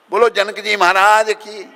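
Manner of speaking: loud, high devotional shout; rallying acclamation. Real, not acted. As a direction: call out for the crowd's response. Leads crowd in acclamation.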